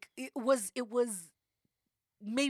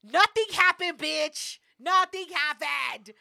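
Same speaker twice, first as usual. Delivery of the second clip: loud, raised-pitch proclamation; emphatic announcement; 4 semitones higher, 7 dB louder, slower than usual. Real, not acted. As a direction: belt out the big reveal. Triumphant reveal of the update.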